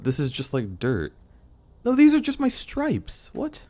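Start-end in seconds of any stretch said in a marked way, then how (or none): 1.8-3.6 squeaky little creature voice; high character voice. Pretend. Character speaks for itself.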